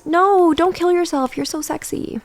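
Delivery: high, slow airheaded coo; sarcastic impression of hollow comfort; mock vapid voice. Shown as a demonstration. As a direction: coo it like empty flattery. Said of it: "has the exact same energy as".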